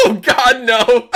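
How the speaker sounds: high, loud laughing through words. Real, not acted. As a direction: burst out laughing in disbelief. Laughing at teammate about to die.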